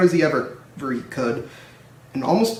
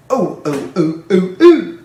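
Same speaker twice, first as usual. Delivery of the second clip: rising cries, louder to a high shriek; trucks screaming; 5 semitones higher, 9 dB louder, slower than usual. A narrator's reading. Trucks jolted by Edward, rising shrieks. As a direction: squeal like startled trucks. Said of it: "screamed the trucks".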